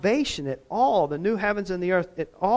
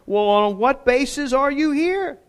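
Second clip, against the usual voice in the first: exasperated angel, higher and louder; frustrated angel; 10 semitones higher, 6 dB louder than usual. Acted. The angel questions the thief, exasperated and loud. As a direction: angel demands answers in frustration. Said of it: "in frustration, the angel says".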